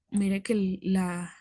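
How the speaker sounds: ordinary delivery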